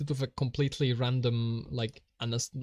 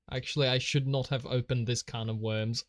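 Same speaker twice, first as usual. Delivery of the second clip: ordinary talk, nothing marked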